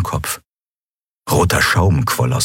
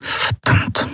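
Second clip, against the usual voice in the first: whispered curse, softer; quiet curse. Acted. Commodore reacting to the death, whispered curse. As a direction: breathe the curse under the breath. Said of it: "sagte Monaro leise".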